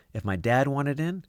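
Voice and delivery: ordinary delivery, nothing marked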